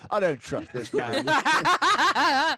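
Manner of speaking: very high, slow, half-laughing squeak; squeaky high laughing voice. Acted. Squeaky voice, player laughing at the suspicious deer. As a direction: pitch it way up and giggle through it.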